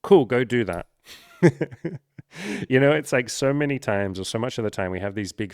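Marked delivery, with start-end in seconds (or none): 1.4-2.6 high laugh. Real, not acted. Laughing at his own advice.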